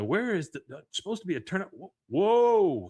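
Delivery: hunt for the way, then break into amazement; startled driver. Retold or imitated. Driver looking for a turnout spots something amazing, astonished.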